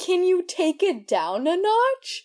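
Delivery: begging mom to tone it down; mock exasperated plea. Retold reply to mother.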